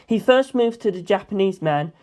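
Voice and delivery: plain reading voice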